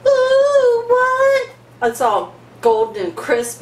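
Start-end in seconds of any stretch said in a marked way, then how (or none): 0.0-1.5 gasp, then a high, loud ooh; amazed gasp. Real, not acted. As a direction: gasp and squeal at the result. Reveals golden baked dish.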